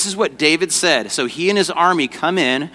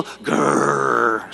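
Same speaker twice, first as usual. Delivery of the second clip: mock growl; 2 semitones lower, 3 dB quieter than usual. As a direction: growl like a booing audience. Growls at villain's name.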